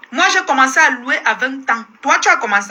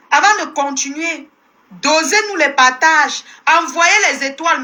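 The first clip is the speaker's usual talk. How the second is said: ordinary talk, nothing marked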